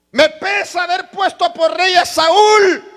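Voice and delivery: slow, high shout of God's regret; shouted divine lament. Acted. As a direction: cry it out like God grieving. (lamento divino a gritos)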